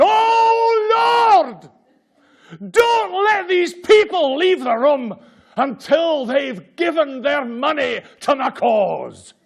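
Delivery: high, loud Scottish shout, slow, begging the Lord; Scottish accent. Retold or imitated. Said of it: "I only do it in a Scottish accent".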